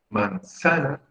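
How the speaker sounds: "manzana" stressed on ZA, high then falling; stress on middle syllable. Shown as a demonstration. Teacher testing stresses for the class, ZA stressed in "manzana". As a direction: say "manzana" as man-ZA-na, leaning on the middle. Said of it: "la sílaba tónica es sa".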